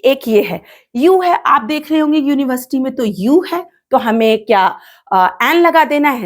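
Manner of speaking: ordinary talk, nothing marked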